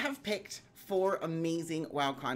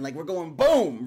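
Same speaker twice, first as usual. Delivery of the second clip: loud emphatic boom; 12 dB louder, slower than usual. Real, not acted. Emphatic boom while explaining.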